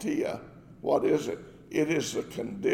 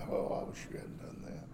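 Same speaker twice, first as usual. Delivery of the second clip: whispered aside; 14 dB quieter, faster than usual. Real, not acted. Rueful aside.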